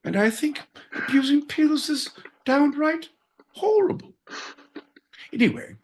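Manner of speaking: playing a prim Londoner in a put-on English accent; mock posh English accent. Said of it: "we're gonna do accents".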